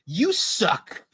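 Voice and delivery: high, whiny little-sister jab; mock whiny sister. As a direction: whine it like a bratty sister. Sister scolding brother.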